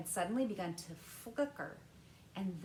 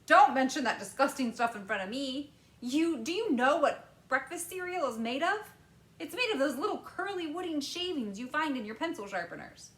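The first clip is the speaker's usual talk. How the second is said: higher, louder Wonka in disgust; disgusted candymaker; 7 semitones higher, 9 dB louder than usual. A narrator's reading. Wonka scolding Mike about breakfast cereal, louder and disgusted. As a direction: sneer at the cereal, loud and lively.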